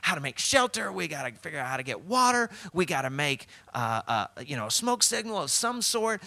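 high, frantic castaway rattling off worries; freaking out. Acted. Castaway urging his sunbathing companion to act, freaking out. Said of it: "The other dude was freaking out".

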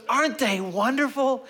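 very high, loud put-on cooing; imitated gushing. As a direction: gush it sky-high, mocking a doting grandfather. Grandfather gushing at tormented father.

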